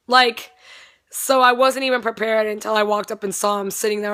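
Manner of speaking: usual speaking voice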